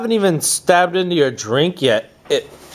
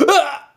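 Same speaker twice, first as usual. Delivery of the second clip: dying scream; 13 semitones higher than usual. Retold or imitated. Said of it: "Or go".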